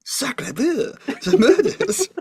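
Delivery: put-on high, laughing French-accent parody; mock French. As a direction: tease with a squeaky fake French accent. Other player mocks the French character.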